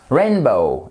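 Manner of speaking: « Rainbow » avec la première syllabe fortement accentuée, puis descente; accent tonique. Shown as a demonstration. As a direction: appuie sur le RAIN de « Rainbow ». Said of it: "attention à l'accent tonique". (« Rainbow » accentué au début, en modèle de prononciation)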